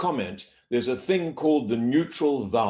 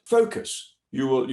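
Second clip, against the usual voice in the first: normal talking voice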